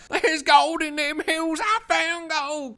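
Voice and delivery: put-on high, slow, cracked-voice crazy old prospector. Tobin Bell digging in the basement, high slow crazy prospector voice. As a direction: stretch it out like a gold-mad old miner. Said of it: "acting like this crazy prospector guy".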